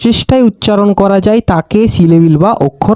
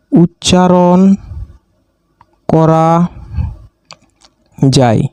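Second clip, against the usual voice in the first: each word alone with long pauses: উচ্চারণ … করা … যায়; word-by-word dictation; 3 semitones lower, much slower than usual. Shown as a demonstration. Teacher dictating to students, one word at a time. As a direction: spell it out slowly for note-takers.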